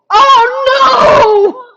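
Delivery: shocked laughing shout; loud shout. Real, not acted. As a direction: yelp in dismay. Realizes inking on wrong layer.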